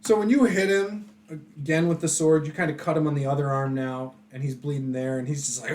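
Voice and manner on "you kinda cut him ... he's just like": normal talking voice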